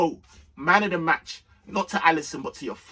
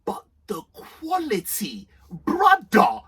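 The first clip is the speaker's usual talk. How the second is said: awestruck praise; 13 semitones higher, 4 dB louder than usual. Real, not acted. Praising the goal in awe.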